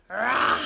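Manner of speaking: loud, fierce mock roar; big playful roar for children; lion roar. Shown as a demonstration. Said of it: "he roars".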